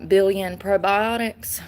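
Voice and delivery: ordinary delivery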